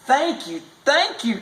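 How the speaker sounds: high, overjoyed thanks; grateful cry. A narrator's reading. Mother thanking Mose for the baby, high joyful cry. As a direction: gush with relief. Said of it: "cried his mother".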